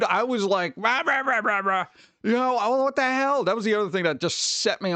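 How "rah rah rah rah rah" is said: mock ranting. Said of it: "I was so ticked off".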